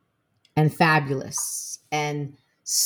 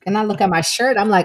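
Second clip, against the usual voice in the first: ordinary talk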